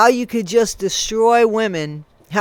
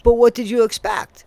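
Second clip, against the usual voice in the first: ordinary delivery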